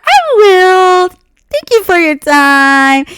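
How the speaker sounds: high, loud, slow fake politeness; sarcastic sweetness. Answering the angry salesman, sarcastically sweet and slow. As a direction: drag out the fake thanks. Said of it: "I was like".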